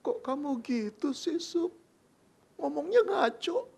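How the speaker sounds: put-on high, soft young woman's whine; Mary softly brushing off Joseph, high; girlish protest. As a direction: pout it back at him.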